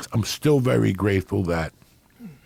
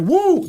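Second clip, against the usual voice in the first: very high loud mock whoop; imitated whoop; 5 dB louder than usual. Johnny bursts out of the bathroom after hours locked in, very high mock whoop. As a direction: shriek it like a man freed after hours locked in. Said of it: "That's the noise he made".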